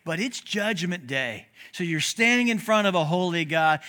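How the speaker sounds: ordinary delivery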